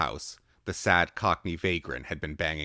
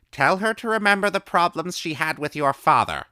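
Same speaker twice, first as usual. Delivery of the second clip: put-on high, loud old woman calling; old woman's voice; 13 semitones higher, 10 dB louder than usual. A narrator's reading. Old woman calling answers to the boy through the door.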